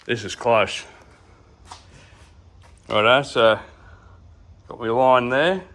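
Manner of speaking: talking around a pen in the mouth; words muffled by a pen held in teeth. Real, not acted. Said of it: "Sorry, I got a pen in my mouth".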